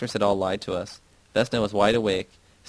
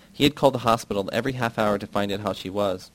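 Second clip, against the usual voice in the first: ordinary delivery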